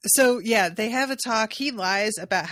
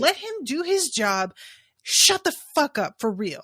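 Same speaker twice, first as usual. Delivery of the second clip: high, loud mock rebuke; scolding wife; 6 semitones higher, 5 dB louder than usual. Wife telling Lord Emmon to stay out of it, scolding. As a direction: act a wife ordering her husband to hush.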